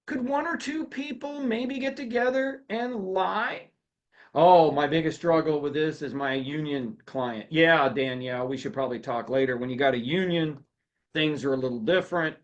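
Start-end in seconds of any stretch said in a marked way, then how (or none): none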